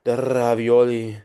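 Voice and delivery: slow, drawn-out fake Italian ravioli; a hint of put-on Italian; mock Italian waiter. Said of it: "he was completely English, but he just went".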